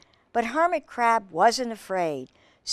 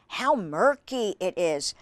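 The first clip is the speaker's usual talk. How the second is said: plain reading voice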